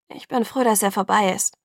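usual speaking voice

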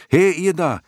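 high, loud cry upward; calling up, shouting. A narrator's reading. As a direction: shout up to get their attention. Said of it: "schrie er hinauf". (hinaufschreien, hoch und laut)

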